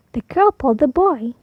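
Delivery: usual speaking voice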